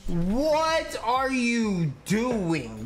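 high, very slow disbelieving shout; exasperated yell. Real, not acted. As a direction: stretch every word in outrage. Raging at teammate mid-game.

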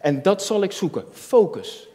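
ordinary talk

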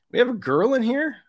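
playful mock disbelief; mock surprise. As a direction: fake astonishment played for laughs. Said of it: "just kidding".

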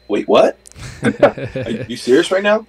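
stunned, laughing through the question; can't believe the call, laughing; laughing as he hears he's going to the NBA; laughing disbelief. Retold or imitated. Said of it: "immediately I was like".

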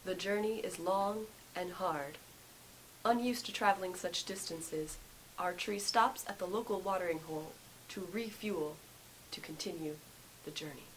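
hushed wildlife documentary narrator; nature documentary. Acted.

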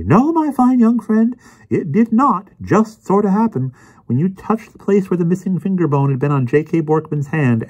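high-pitched, emphatic pompous correction; Emerson's know-it-all voice. Acted. Voices Emerson lecturing Anthony.

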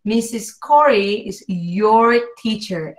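slow, with the R of "your" and "teacher" overdone; exaggerated R. Shown as a demonstration. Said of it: "Exagérenlo".

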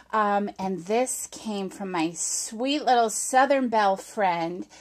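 usual speaking voice

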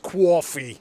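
"coffee" said as "kwoffee"; exaggerated accent on "coffee", as "KWAW-fee"; mock New York pronunciation. Shown as a demonstration. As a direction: put a w after the c in "coffee". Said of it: "you'll find it's pronounced coffee".

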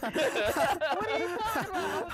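high, loud, slow laughing protest; mock-innocent laughing; laughing denial. Real, not acted. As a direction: deny it while giggling.

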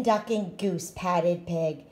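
ordinary delivery, nothing marked

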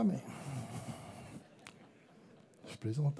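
ordinary delivery

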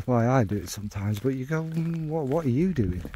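normal talking voice

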